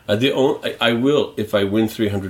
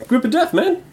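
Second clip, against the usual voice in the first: much higher, squeaky voice; helium voice. Acted. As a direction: talk like you inhaled helium. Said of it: "Why is my voice so high?".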